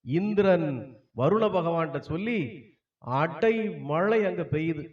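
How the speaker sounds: usual speaking voice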